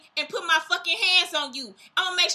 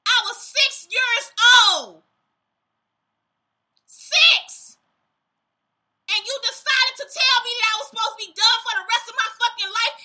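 furious shout; 5 semitones higher, 4 dB louder than usual. Real, not acted. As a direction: yell it in real outrage. Furious rant about childhood abuse.

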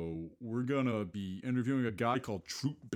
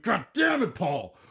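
much higher, louder cry of frustration; mock self-scolding shout; 9 semitones higher, 8 dB louder than usual. Host fumbles his intro and yells at himself, exasperated. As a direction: yell at yourself for the blunder.

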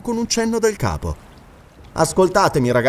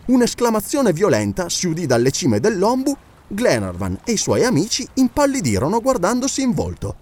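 ordinary delivery, nothing marked

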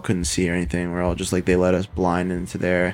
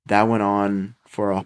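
usual speaking voice

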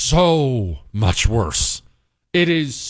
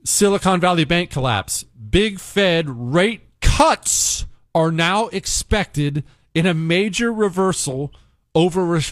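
ordinary delivery

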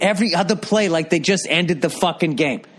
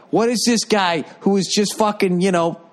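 ordinary talk, nothing marked

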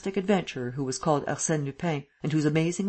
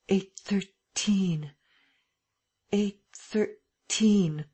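slow hushed repetition; repeating under breath; 5 semitones higher, much slower than usual. A narrator's reading. Lupin trying to remember, hushed and slow. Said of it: "They heard him repeating".

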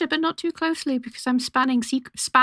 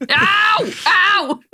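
sharp, high cry of real pain; pained yelp; 9 semitones higher, 5 dB louder than usual. Real, not acted. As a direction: yelp as a cat bites. Cat attacking her mid-podcast.

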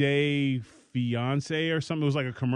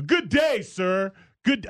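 much higher and louder, lofty snub; loud haughty good day; 5 semitones higher, slower than usual. Shown as a demonstration. Answering a friend's late text, loud lofty snub. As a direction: blast a grand dismissive good day. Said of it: "you guys use this from now on".

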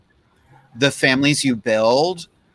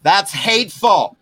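sudden high, loud yell to her husband offstage; 8 semitones higher, 4 dB louder than usual. Real, not acted. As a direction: call out sharply to the next room. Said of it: "let me yell at my husband".

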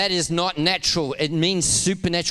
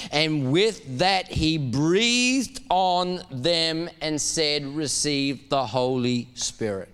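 slow solemn reading; 2 semitones lower, much slower than usual. Acted.

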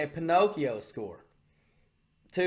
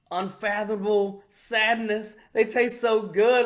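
slow, raised, savouring cartoon voice; Cartman; 4 semitones higher, 5 dB louder, slower than usual. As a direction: draw it out in blissful cruelty. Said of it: "he starts licking his face like".

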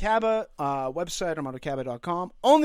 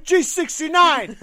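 bingo caller; 6 semitones higher, 8 dB louder, slower than usual. Acted. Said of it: "Bingo caller".

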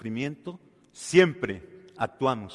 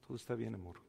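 soft comfort; 2 semitones lower, 14 dB quieter, much faster than usual. Acted. Husband comforts crying wife.

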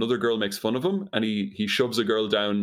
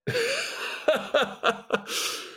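high-pitched helpless giggle; real laughter. Real, not acted. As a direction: crack up, laughing high and long. Cracks up at the bee joke.

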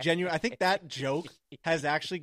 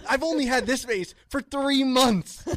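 higher, louder, slow indignant protest; mock outrage; 6 semitones higher, 5 dB louder than usual. Arguing with his parents over an old photo, higher and louder in mock outrage. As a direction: play up the wounded son. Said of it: "And I was like".

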